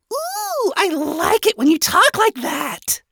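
very high, squeaky, drawn-out voice; squeaky, lecherous admiration; smitten flirting. A narrator's reading. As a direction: coo it slowly in a tiny high voice. Nifty flirts with the scientist.

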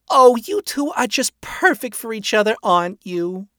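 high, loud, delighted matchmaker; gushing hostess. A narrator's reading. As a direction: gush over the pair in a high voice. Rosie applauds and gushes over them.

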